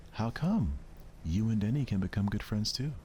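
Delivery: bubbly friend vouching for Denny; cheerful Sayori. Acted. Sayori reassuring the club about Denny, cheerful.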